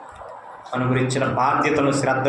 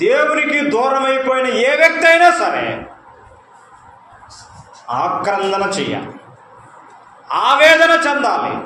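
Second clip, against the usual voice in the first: impassioned cry; 8 semitones higher, 4 dB louder than usual. Real, not acted. Preacher's impassioned outcry.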